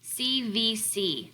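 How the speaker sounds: C V C letter by letter, slowly; teacher spelling out the pattern letters; spelled out slowly. Shown as a demonstration. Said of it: "that pattern".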